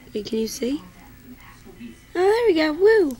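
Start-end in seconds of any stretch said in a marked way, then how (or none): 2.2-3.2 high, loud happy squeal; excited. Real, not acted. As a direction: squeal with delight at the bird. Cheering at her bird.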